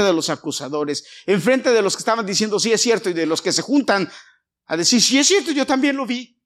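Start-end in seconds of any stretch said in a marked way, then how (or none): 2.6-3.1 higher, louder mimic of a busybody; mock gossip. Mocking gossiping accusers. 5.0-6.4 much higher, louder busybody voice; mocking gossip.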